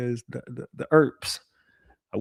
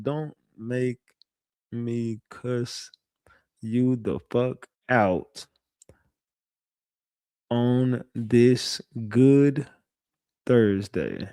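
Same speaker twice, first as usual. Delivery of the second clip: slow menacing warning; much slower than usual. Shown as a demonstration. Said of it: "I'm finna cuss her ass out".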